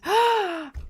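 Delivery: high sudden gasp; sharp excited gasp. Real, not acted. Streamer gasps, thrilled about grimoires. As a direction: gasp with delight.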